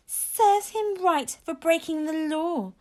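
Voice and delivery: high, drawn-out sneer; slow, high-pitched spiteful jeer. A narrator's reading. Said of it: "sneered Fireworm to Sea Slug".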